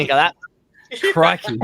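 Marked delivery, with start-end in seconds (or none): 1.1-1.6 lightly put-on Aussie catchphrase; Steve Irwin. Capping the reading for friends, mild mock Aussie accent. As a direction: toss in a mock Australian catchphrase. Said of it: "end every sentence with "crikey".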